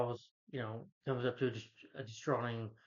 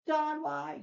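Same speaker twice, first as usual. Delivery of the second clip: much higher, louder hammed-up wail to the sky; mock anguished cry; 3 dB louder than usual. Parodying Fassbender's anguished Apocalypse scene, hammed-up high wail. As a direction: overact a tortured cry of grief. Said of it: "It's more horrendous in Apocalypse when it's like".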